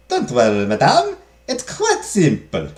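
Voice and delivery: put-on maid accent, soothing madame; reassuring maid. Maid explains parties to madame, reassuring.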